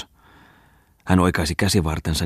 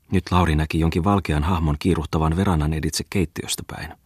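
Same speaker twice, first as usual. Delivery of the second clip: ordinary delivery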